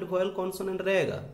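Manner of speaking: ordinary talk, nothing marked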